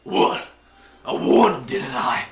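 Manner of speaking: loud, rough self-justifying voice; defiant Skiono. Acted. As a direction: challenge the whole room loudly. Killer defends himself to the room.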